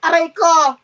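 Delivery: ordinary delivery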